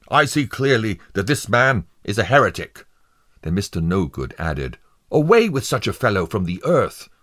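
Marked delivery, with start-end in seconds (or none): 0.1-2.9 loud, high-pitched accusation; exclaiming. A narrator's reading. Foreman giving his verdict on the prisoner, exclaiming. 5.1-7.1 high, loud and fast outburst; vehement condemnation. A narrator's reading. Juror demanding the prisoner be removed, vehemently.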